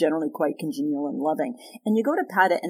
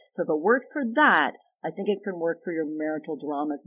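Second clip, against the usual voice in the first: ordinary delivery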